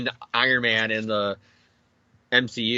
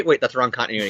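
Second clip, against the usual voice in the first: ordinary talk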